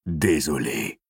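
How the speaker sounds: low, level voice in his head; deep monotone voice. A narrator's reading. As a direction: answer low, with no emotion. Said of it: "une voix grave et monocorde". (voix grave et monocorde)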